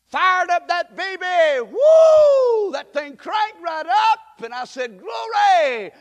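high, loud rising joy; preacher crowing over the fixed car; excited shout. Real, not acted. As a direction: whoop it up like the engine fired.

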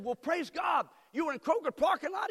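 loud, high shout of praise; joyful shout. Retold or imitated. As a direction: burst out with surprised joy. Pastor retells his joyful reaction.

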